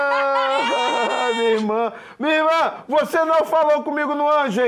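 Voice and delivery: high, loud, joyful calling out; overjoyed to hear family; excited greeting. Real, not acted. Greets his sister on call.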